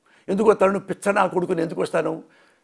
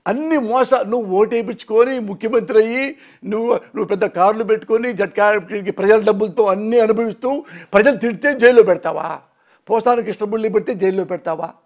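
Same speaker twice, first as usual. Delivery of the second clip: raised, heated, rising to a shout; angry accusation; 4 semitones higher, 3 dB louder than usual. Real, not acted. Railing at the chief minister in anger. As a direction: hurl the accusations at him.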